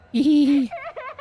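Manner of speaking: high, wild giggle of madness; crazed laugh. Acted. Crew member gone mad, high crazed laugh. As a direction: laugh like someone losing their mind.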